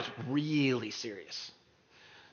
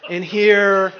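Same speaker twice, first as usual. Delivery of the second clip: stewardess safety demo; 4 semitones higher, 7 dB louder than usual. Retold or imitated. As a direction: recite the routine like a cabin attendant. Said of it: "with all the stewardesses where they go".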